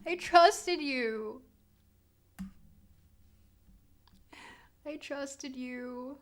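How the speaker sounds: slow, hammed-up wounded plea; mock hurt. Streamer pretending heartbreak to chat, slow mock hurt. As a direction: drag out the betrayal. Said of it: "I'm not about to cry".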